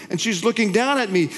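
ordinary talk, nothing marked

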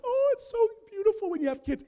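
high, sugary mock gushing; gushing well-wishers. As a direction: mimic sweet advice-givers. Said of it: "Everyone would tell us".

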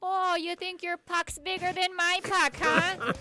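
high, drawn-out Minnesota taunt; thick Minnesota accent. Retold or imitated. Imitating a hockey mom taunting the other team, Minnesota accent. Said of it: "really thick Minnesota accent".

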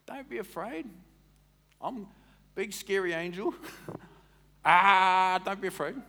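high, hammed-up big scary angel; booming mock angel with a laugh. Preacher joking the angel terrifies Mary, hammed-up voice. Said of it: "Because angels are scary".

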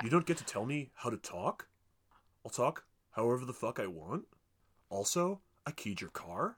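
each sentence lifted at the end like a question; deadpan threats with questioning endings; mock uptalk. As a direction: tell them off in questions, rising on "talk", "want" and "car". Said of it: "goes up at the end".

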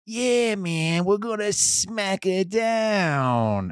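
high, drawn-out goon voice; thug sidekick. Acted. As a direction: play a slow sneering goon. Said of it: "the next one, from the other side".

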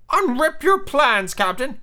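very high, slow sidekick voice; high-pitched keen henchman; eager Smee. Acted. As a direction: urge the captain high and eagerly. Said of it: "he cried eagerly".

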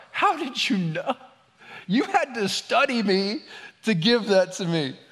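high, drawn-out amazed disbelief. Acted. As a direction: act out being astonished by a thoughtful gift. Imagined amazed receiver of a gift.

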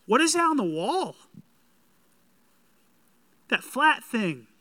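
amazed. Acted. Visitor amazed at the TV.

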